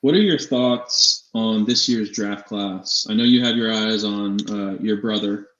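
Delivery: ordinary talk, nothing marked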